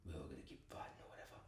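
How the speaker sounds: fast, breathy throwaway whisper; whispered mock pep talk. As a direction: toss it off in a bored whisper. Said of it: "he's like".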